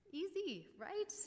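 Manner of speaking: high-pitched, tongue-in-cheek lightness; mock breezy. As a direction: toss it off in a high, ironic lilt. Joking after very hard demands.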